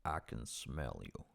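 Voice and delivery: low, slow Morgan Freeman voice; Morgan Freeman impression. Friend asks him to do Morgan Freeman, low slow impression. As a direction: drop low and slow like the narrator. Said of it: "Morgan Freeman's voice".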